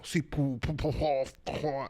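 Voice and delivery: imitated French gibberish, puffed syllables; mock French. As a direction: babble like a caricature French speaker. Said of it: "there was a French person, like, talking".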